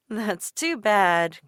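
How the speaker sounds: slow, offhand; breezy quip. A narrator's reading. Passing lady brushing Dolores off, slow. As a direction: brush her off lightly. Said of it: "quipped the lady".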